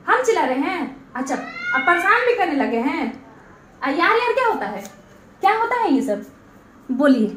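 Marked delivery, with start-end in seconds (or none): none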